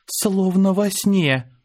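slow, high, awed hush; dreamy wonder. A narrator's reading. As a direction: breathe it out in disbelief. Awestruck in the luxury suite.

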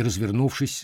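plain reading voice

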